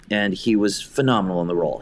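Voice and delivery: ordinary talk, nothing marked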